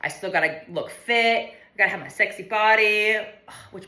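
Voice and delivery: ordinary delivery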